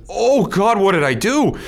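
high, strained voice of sudden regret; retold dread. Realizing his depression op-ed is going public, panicked. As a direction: gasp it out as it hits you. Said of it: "I went".

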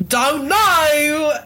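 a high mock British mumble; British accent. Imitating Edwin answering his mother, high mock British accent. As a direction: toss it off in a sulky British accent. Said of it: "in his, like, shitty British accent".

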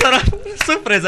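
ordinary delivery

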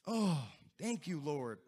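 ordinary talk

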